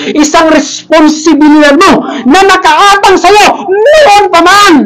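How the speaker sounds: building from firm to high, drawn-out shout; forceful preaching. Real, not acted. Pastor charging the congregation with their race, forceful preaching. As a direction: preach it slowly, rising to a climax.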